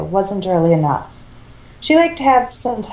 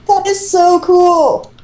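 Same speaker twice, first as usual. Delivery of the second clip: much higher, slow, gushing; excited; 10 semitones higher, 5 dB louder, slower than usual. A narrator's reading. Teen intruder marvels at Tesla gun, excited. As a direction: gasp it like a fanboy. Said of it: "The second voice again".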